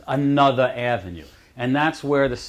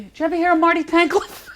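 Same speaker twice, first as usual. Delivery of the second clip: naive question; 4 dB louder, faster than usual. Retold or imitated. Retold naive question, high.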